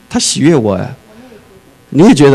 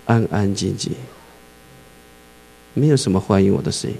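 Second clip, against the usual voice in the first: quiet hush; 5 semitones lower, 7 dB quieter than usual. Retold or imitated. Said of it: "他说他到别的地方去啊".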